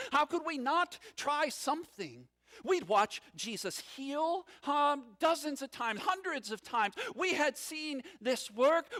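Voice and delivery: ordinary talk, nothing marked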